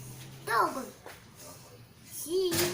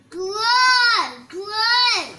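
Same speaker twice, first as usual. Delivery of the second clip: loud calling out; 8 dB louder than usual. Real, not acted. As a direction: shout the word out, louder. Child shouting the card.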